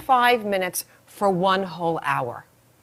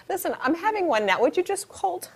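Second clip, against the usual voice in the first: high, tightening voice; pitched up, straining to talk; strained by contraction; 9 semitones higher, 4 dB louder than usual. Acted. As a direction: play pain rising mid-call. Acts caller starting a contraction.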